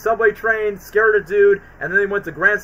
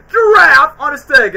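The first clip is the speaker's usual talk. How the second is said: loud, higher, slow shouted line; mock shout; 8 semitones higher, 10 dB louder, slower than usual. Alex yells at Melman, mock shout. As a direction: bellow it like a cartoon lion. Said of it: "where he goes".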